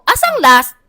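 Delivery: calling out to the whole line; loud call-out. Retold or imitated. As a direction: announce it loudly to everyone. Announcing loudly in the queue.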